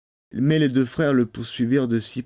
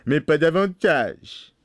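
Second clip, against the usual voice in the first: slow, higher, emphatic warning; stern Bluebeard; 6 semitones higher, slower than usual. A narrator's reading. Bluebeard warning his wife, slow and stern. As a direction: draw out the limit firmly.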